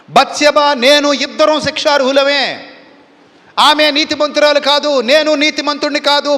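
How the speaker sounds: high, loud admission of guilt; anguished confession. Acted. King admitting his sin before the people, loud and anguished. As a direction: pour out the guilt, climbing and pleading. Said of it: "అని ఒప్పుకోవడం మొదలుపెట్టాడు".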